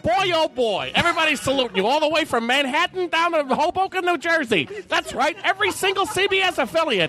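put-on breathless old-time newsreel announcer; old-time radio announcer. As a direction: hype it like a 1930s radio man. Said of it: "C B S boys from nineteen thirty-eight".

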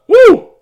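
short, high, loud whoop. Real, not acted. Host breaking off mid-thought, loud whoop. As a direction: let out a sudden high woo. Spontaneous whoop between remarks.

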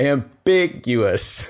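Ambiguous drawn out slowly, each syllable placed; slow careful ambiguous. Shown as a demonstration. Host corrects himself, slowly saying Ambiguous. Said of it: "That's the term I was looking for".

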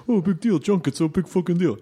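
high, sing-song mock scoff; mocking doubter. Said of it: "to anyone who thinks".

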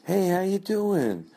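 high sugary party-host voice; fake-friendly host. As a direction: greet like an overly sweet hostess. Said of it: "I started to say it like".

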